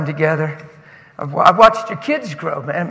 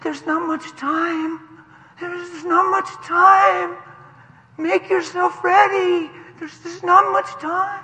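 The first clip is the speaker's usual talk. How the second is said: put-on high, slow, urgent child's plea; crying four-year-old; 9 semitones higher, slower than usual. Four-year-old warning the church during worship, imitated crying plea. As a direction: imitate a small child tearfully warning everyone. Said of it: "He's standing over there shaking and crying".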